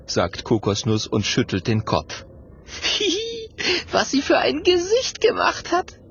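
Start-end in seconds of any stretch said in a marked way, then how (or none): 2.6-6.0 very high, giggly character voice; giggle, then speak with a grin; high, tittering relief; relieved giggle. A narrator's reading. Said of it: "grinst Mathilda erleichtert".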